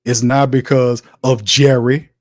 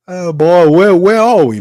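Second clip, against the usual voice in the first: louder and higher, fed up; exasperated outcry; 7 semitones higher, 7 dB louder than usual. Real, not acted. Host to his fans, exasperated loud outburst. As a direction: throw up your hands, loud and exasperated.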